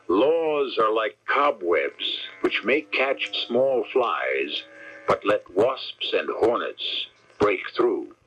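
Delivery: each phrase drawn out slowly; slow solemn quote. Acted. Radio host reading a quotation slowly. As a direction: savor the words like an old saying.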